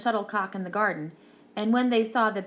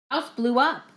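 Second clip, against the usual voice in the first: ordinary delivery, nothing marked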